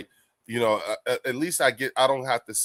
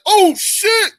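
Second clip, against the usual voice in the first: very high, loud excited outburst; Fells; 10 dB louder than usual. Retold or imitated. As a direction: imitate a hyped friend exploding with excitement. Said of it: "he'd have been like".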